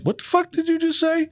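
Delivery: high-pitched incredulous retort; baffled disbelief, pitched high. Retold or imitated. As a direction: quote a startled reaction, pitch jumping up. Quoting own startled reaction to friend.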